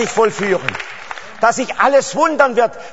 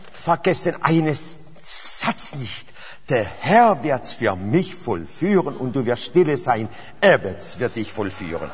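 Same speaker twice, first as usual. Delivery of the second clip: much softer and lower, half-breathed; near whisper; 8 semitones lower, 6 dB quieter than usual. Real, not acted. Preacher quietly giving listeners one sentence to keep, near whisper. Hushed charge to remember.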